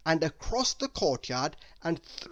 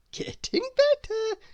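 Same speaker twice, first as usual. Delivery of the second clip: chuckling; 4 dB louder than usual. A narrator's reading. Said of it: "she chuckled".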